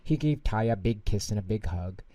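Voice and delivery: usual speaking voice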